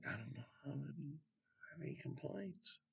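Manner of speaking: very soft, tentative reply; acted quiet musing. Someone asked for complaints who can find none, quiet musing. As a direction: murmur it as if searching and finding nothing. Imagined person searching for any complaint.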